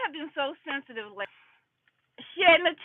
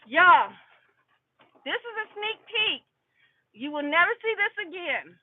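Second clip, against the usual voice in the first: usual speaking voice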